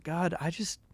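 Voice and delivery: anxious prayer. Retold or imitated.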